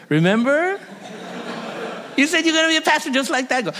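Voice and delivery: father playfully reminding his son; teasing reminder. Retold or imitated. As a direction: rib him about his old promise. Teasing grown son about promise.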